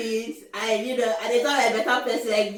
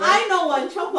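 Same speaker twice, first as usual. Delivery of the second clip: child's attempt at Pidgin; 7 semitones higher, faster than usual. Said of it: "their attempt at Pidgin".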